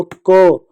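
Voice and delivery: "क" held so its "अ" ending is audible; "क" with a long, sustained "अ" tail; drawn-out vowel. Shown as a demonstration. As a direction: lean on the vowel at the end of "क". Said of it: "last में अ ऐसे सुनाई देगा".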